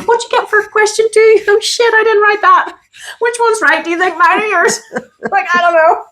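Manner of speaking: anxious exam student. Retold or imitated. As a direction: play a panicky kid after a test. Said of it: "like in an exam".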